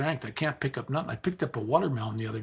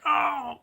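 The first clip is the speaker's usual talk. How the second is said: pained yelp; 5 dB louder than usual. Retold or imitated. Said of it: "It's like".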